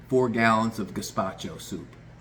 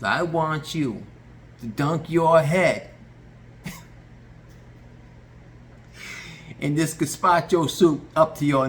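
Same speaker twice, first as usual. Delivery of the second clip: slow, higher, louder spelling out; drawn-out dare; 5 semitones higher, 4 dB louder, slower than usual. Retold or imitated. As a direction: stretch out every word for effect.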